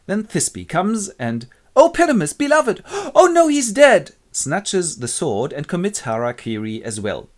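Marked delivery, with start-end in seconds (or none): 1.7-4.1 high, loud tragic wail; hammy grief. Acted. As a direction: wail like an overacting heroine. Thisbe finds Pyramus dead.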